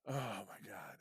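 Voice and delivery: breathy exasperated whisper. Real, not acted. Dad whispering at the end of his story, exasperated whisper. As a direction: whisper it, worn out by the weekend. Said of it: "whispered aside".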